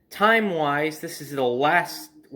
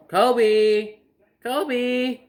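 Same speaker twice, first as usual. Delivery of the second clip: mother calls the dog again, louder and higher; calling louder; 9 semitones higher, 4 dB louder than usual. Retold or imitated. Mother on the deck calls the dog again, higher and louder. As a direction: shout it across the yard, higher. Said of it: "And she calls him".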